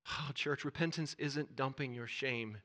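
usual speaking voice

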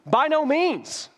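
high, loud, slow "By no means" shouted; mock shout. Pastor acting out Paul shouting at the Romans, hammed-up shout. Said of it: "Like he's shouting it".

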